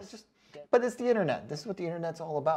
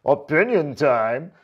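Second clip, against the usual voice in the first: slow, self-important announcement; pompous stuffy guy; 8 dB louder, slower than usual. Retold or imitated. Imagining commenter straightening his tie, pompous announcement. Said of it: "imagine this guy straightening his fucking tie".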